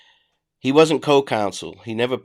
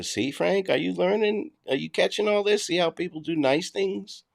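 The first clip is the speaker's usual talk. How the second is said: higher, softer mock-teacher voice aimed at Frank; sarcastic lesson to Frank; 8 semitones higher, 3 dB quieter than usual. Streamer needling absent critic Frank, sarcastic sing-song mock lesson.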